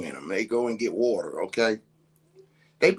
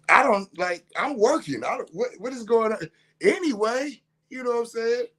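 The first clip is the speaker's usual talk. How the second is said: incredulous and fed up; exasperated; 9 semitones higher than usual. Retold or imitated. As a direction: voice climbing in disbelief. Kids talking back, frustrated.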